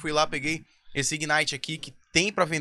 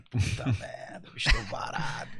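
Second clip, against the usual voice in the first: hushed whisper, half laughing; 4 semitones lower, 5 dB quieter than usual. Retold or imitated. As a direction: whisper it, laughing under breath. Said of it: "Eu falei".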